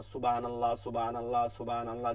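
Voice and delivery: low, rattled-off সুবহানাল্লাহ in a monotone; hurried zikr. Retold or imitated. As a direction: run সুবহানাল্লাহ together with short vowels. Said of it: "জিকির করতেছে". (তাড়াহুড়ো করে একটানা জিকির)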